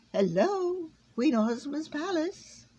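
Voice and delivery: high, slow, drawn-out greeting; formal phone greeting. A narrator's reading. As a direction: answer grandly, stretching each word. Bug answers the phone formally.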